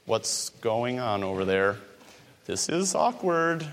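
low, soft, uneasy aside; hushed, hesitant mock bewilderment; acted awkward unease. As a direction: play an uncomfortable onlooker under your breath. Imagined Pharisee watching the awkward scene.

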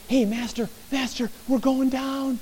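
high but hushed fearful cry; frightened cry. Acted. Another disciple fearing the boat will sink, high and hushed. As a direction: call out, scared, that you're sinking. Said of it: "someone else coming to him and saying".

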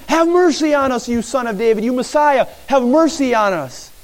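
high-pitched imitated cry for mercy; acted yelling. Two blind men begging Jesus from the crowd, acted yelling. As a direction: call out urgently like men in a crowd. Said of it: "yelling, screaming".

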